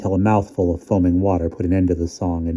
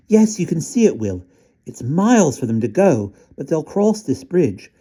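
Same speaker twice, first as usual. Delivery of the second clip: put-on high child voice; 9 semitones higher than usual.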